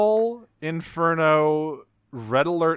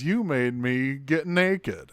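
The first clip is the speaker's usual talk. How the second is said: lightly camp robot voice, slow; slow campy Trax; slower than usual. Retold or imitated. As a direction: drawl it sulkily. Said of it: "he says".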